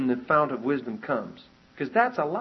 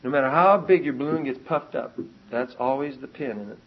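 normal talking voice

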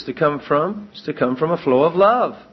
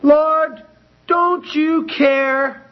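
high, slow, drawn-out pleading; Martha's accusing cry; 11 semitones higher, much slower than usual. Acted. Martha crying out to Jesus, high drawn-out accusation. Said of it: "to accuse his nature".

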